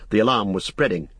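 ordinary delivery

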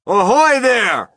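long, high hailing call; loud, drawn-out shout; police chief shouting. A narrator's reading. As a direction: holler up to someone far above. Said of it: "shouted the chief of police".

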